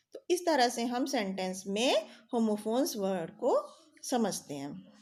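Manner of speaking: ordinary delivery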